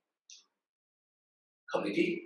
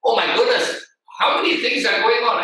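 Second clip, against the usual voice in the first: higher, mildly exasperated outburst; overwhelmed exasperation; 5 semitones higher, 4 dB louder than usual. Acted. A listener drowning in everyone's meeting updates, exasperated. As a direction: act a listener swamped by updates.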